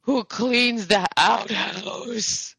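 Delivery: put-on high, very slow maudlin drunk; hammed-up weepy drunk, stretching every word; pathetic crying drunk. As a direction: drag out the last words in drunken despair. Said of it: "pathetically drunk and kind of, like, just crying".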